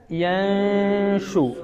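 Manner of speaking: very slow, soft യൻഷു with the ന hidden in a nasal hum; recited with ikhfa. Shown as a demonstration. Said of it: "എങ്ങനെ മണിക്കേണ്ടത് ഇവിടെ".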